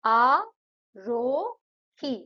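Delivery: आरोही said as आ, रो, ही, one syllable at a time; split into syllables. Shown as a demonstration. Teacher shows pupils आरोही split into आ, रो, ही. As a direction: break आरोही into आ, रो, ही with pauses. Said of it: "आरोही में तीन syllable हैं".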